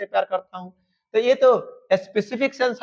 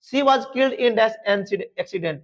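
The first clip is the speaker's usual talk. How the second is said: dictation pace; much slower than usual. Shown as a demonstration. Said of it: "teacher dictating sentence".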